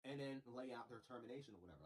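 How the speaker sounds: ordinary talk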